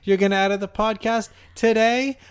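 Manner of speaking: high, loud teasing disbelief; mock surprise. Real, not acted. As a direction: tease him like it's a big deal. Teasing partner about hosting.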